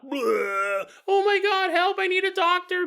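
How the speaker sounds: gag, then a high panicked plea; hammed-up sick student begging for help; retching cry for help. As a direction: fake retch and call for a doctor. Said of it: "people in their dorm rooms throwing up".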